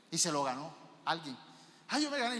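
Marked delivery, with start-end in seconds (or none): 1.9-2.4 high-pitched mock bragging; mock showing off. Preacher voices the humility contest winner, mock showing off. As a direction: play the proud winner in a raised voice. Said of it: "empezó a chicanear".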